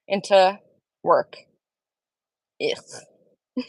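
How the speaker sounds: ordinary talk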